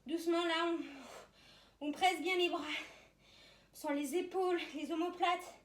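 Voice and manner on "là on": ordinary talk, nothing marked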